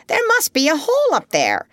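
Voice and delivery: high, excited exclamation; urgent high-pitched cry. A narrator's reading. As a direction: announce the discovery in a high character voice. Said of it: "cried Polynesia".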